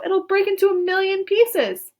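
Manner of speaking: high frightened outcry; alarmed shout. A narrator's reading. Grandpa Joe fearing the glass will shatter. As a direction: warn in panic. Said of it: "shouted Grandpa Joe".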